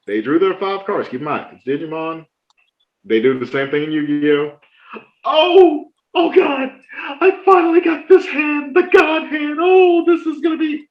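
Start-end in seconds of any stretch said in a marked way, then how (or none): 5.3-10.9 high squeaky overexcited gush; mock excited opponent. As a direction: ham up a player thrilled by his god hand. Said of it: "There was somebody".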